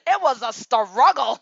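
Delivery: loud and high through laughter; laughing. Real, not acted. Admitting money trouble, laughing loudly. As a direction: laugh the words out.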